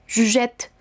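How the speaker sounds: "je jette" with the final T sounded firmly; strong double T. Shown as a demonstration. Teacher showing students the T in "jette", firm T. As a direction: land hard on the T of "jette". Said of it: "esta sonará fuerte, la T".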